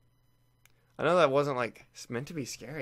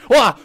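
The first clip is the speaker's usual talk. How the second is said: startled shriek; 9 semitones higher, 17 dB louder than usual. Real, not acted. Jump scare.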